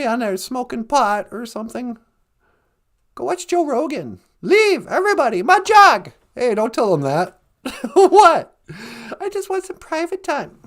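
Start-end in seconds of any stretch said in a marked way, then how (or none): 4.4-6.1 loud shooing. Acted. Shooing everyone out.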